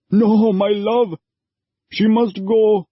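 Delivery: put-on father telling his wife their girl must go; anguished father. A narrator's reading. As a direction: hold her back, heartbroken. Father holds her back.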